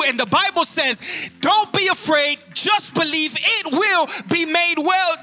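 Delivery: high, loud, rising sermon cadence; fiery pulpit preaching; preaching at full force. Acted. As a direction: drive each line home to the congregation.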